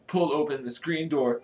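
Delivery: plain reading voice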